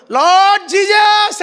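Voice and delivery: high, drawn-out shout to God; loud desperate cry. Acted. Mother facing tigers calls on the Lord, acted loud cry. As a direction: cry out slowly with all your strength, acted. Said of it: "గట్టిగా ఆమె శక్తి కొద్దీ ప్రభువును పిలిచిందంట".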